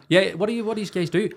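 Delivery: lightly voiced hostess; mock hostess question. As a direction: play her curious.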